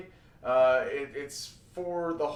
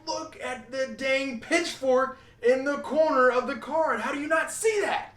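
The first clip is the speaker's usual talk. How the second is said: exasperated; 10 semitones higher, 3 dB louder than usual. Retold or imitated. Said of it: "when you're like".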